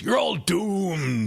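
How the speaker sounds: slow, high, ominous doomsayer; slow ominous raspy drawl. Acted. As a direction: drag out the doom warning. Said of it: "the guy that just goes".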